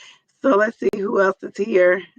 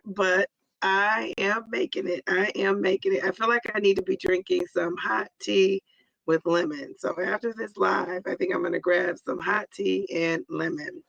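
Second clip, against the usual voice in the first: ordinary talk, nothing marked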